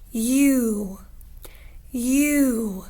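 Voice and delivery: a rounded, held "you"; long U demo. Shown as a demonstration. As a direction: model "you" for the class to watch. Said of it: "Watch me".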